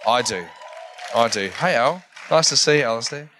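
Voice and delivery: ordinary talk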